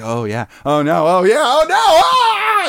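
hammed-up ecstasy and dread, rising to a scream; mock climax into scream. Acting out man's death during sex.

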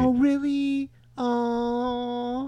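high, sarcastic oh really; mock amazement at his own rambling; self-mocking surprise. Guest mocking himself with a high, sarcastic oh really. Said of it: "I'm making fun of myself".

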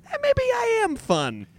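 very high, squeaky little protest; hammed-up high-voiced shrug; mock self-defence. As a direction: jump way up in pitch, half laughing at yourself. Acts out his own self-defence.

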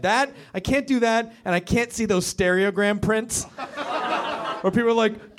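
ordinary talk, nothing marked

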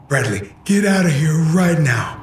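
high, strained shout at a son; angry father. Acted. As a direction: bark the order like a furious dad. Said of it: "He was angry".

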